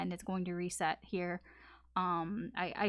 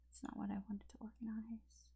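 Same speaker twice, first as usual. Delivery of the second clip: whispered to self; 13 dB quieter than usual. Real, not acted. Muttering to herself while organizing.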